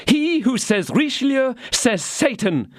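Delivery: high, ringing condemnation; brand the cardinal as the devil; wife denouncing Richelieu; fierce denunciation. A narrator's reading. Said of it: "cried the young woman".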